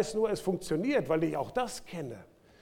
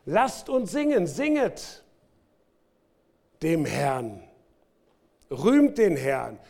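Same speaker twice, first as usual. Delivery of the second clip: rally the congregation: singet dem Herrn, rühmt den Herrn; rousing preaching; 4 dB louder, slower than usual. Acted. Prophet calling everyone to sing, rousing. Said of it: "Er fängt doch wieder an zu predigen".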